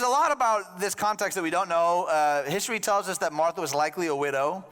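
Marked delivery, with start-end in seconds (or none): none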